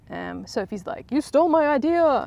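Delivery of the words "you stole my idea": higher and louder, indignant; outraged accusation. Acted. Sophie accusing Catherine of stealing her idea, loud outrage.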